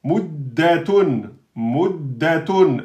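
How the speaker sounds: "Muddatun" slow with a held double d; doubled dal. Shown as a demonstration. Teacher models a shadda for students. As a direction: lean on the doubled d. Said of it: "le dal, il porte une shadda".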